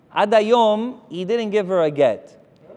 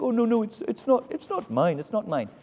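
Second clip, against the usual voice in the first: lightly imitated British groom, higher then dropping; bashful British groom; 5 semitones higher than usual. British groom tells his bride the ring isn't his, lightly voiced bashful protest. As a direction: play a shy British man politely protesting. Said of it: "oh, he's British".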